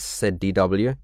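plain reading voice

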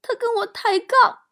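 hammed-up, very high sobbing voice; choked with tears. Aunt tearfully explains her fall downstairs, choked and squealing. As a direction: wail it out in mock sobs, swelling loud near the end. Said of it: "哽咽着说".

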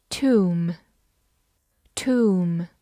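Tomb ending on an M sound; silent B. Shown as a demonstration. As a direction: say Tomb as "toom", no B. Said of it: "往往B不发音哦".

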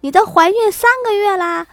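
high, loud mock astonishment; loud exclamation. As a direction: cry it out for everyone around to hear. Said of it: "闺蜜听了立刻大声".

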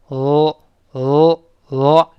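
held L, written “哦”, tongue tip on the palate; L sound demo. Shown as a demonstration. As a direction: hold the L with tongue pressed forward. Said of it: "往前指，顶住". (舌尖顶住上颚发L)

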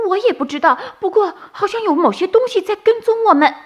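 high, fast nervous little rabbit; uneasy bunny. A narrator's reading. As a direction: hurry out a worried warning. Bunny whispers warning. (小兔不安的尖声)

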